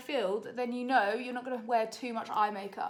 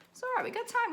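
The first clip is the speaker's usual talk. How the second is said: self-reassuring; 12 semitones higher, faster than usual. Real, not acted. Racing the makeup timer.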